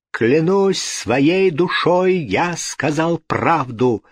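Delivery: high, slow, emphatic oath; crying out. A narrator's reading. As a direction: swear it with drawn-out fervour. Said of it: "вскричал Руджиери".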